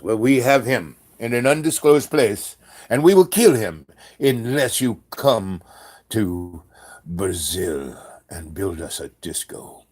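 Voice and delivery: put-on menacing caller in a vague European accent; movie-trailer threat with a European accent; strange European accent. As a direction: start loud, then slow right down in an odd French-ish accent. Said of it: "this voice with a kind of accent".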